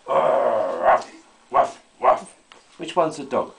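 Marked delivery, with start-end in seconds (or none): 0.1-2.3 mock dog bark.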